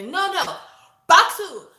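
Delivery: high, loud imitated yell; a loud, high mock order; mock coach shout. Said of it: "and do another".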